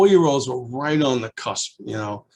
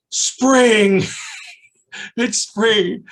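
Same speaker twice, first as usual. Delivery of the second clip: high, loud, laughing announcement; loud high child's delight; thrilled child; 10 semitones higher, 7 dB louder, much slower than usual. Retold or imitated. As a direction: cry out the discovery with joy. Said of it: "And he goes".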